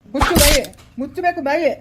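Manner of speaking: loud, angry order to shut up; angry command. Acted. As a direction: silence them with an angry outburst. Acted father-in-law silencing the crying woman. (ಸಿಟ್ಟಿನಿಂದ ಗದರಿಸುವುದು)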